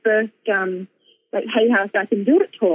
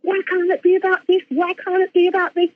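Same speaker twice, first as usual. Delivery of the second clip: higher-pitched, protesting complaint; retold anger; 7 semitones higher than usual. Her angry part protesting her message, retold anger. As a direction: act out an indignant rant at unfairness. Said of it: "really angry".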